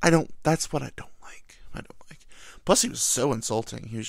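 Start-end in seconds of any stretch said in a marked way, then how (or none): 0.0-2.2 hushed confession to camera; murmured aside; whispered aside. Real, not acted. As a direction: drop to a whisper, half to yourself.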